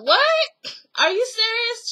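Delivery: high, squealing mock disbelief; shocked disbelief. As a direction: imitate her jaw-dropping surprise at the house. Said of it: "She was like".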